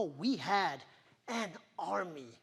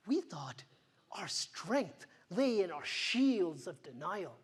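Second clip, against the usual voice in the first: ordinary delivery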